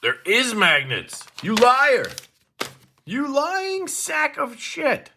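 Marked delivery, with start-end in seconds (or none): 1.4-5.1 mock outrage. Mock accusing the knife.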